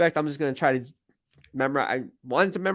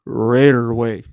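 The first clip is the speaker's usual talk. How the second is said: low, loud announcer imitation; attempted announcer voice; 5 semitones lower, 7 dB louder, slower than usual. Shown as a demonstration. Host trying out the authentic voice for the address, hammed-up announcer. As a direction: put on a big, deep announcer voice. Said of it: "I don't have that voice".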